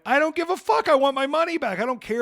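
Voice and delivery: high, loud furious outburst; shouting at the company on the phone; retold angry yell. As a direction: yell it back at customer service. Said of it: "And I went".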